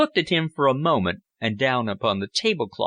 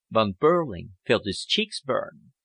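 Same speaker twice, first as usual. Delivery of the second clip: ordinary delivery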